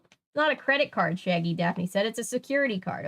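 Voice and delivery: animated read-aloud, deliberate phrasing; story narration. A narrator's reading. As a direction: read the mystery out to listeners with lift.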